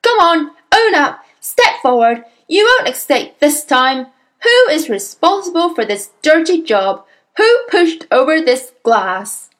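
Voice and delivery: loud, angry demands; bully a whole class into confessing; roaring. A narrator's reading. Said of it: "she roared".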